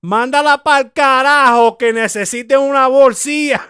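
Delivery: loud, high scolding grandma in Spanish; grandma's voice. Retold or imitated. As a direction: belt it out like an angry abuela. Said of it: "I heard my grandma".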